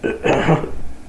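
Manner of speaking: sharp involuntary hiccup; a real hiccup jolting out. Real, not acted. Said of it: "I've been hiccuping nonstop".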